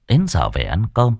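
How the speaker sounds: ordinary delivery, nothing marked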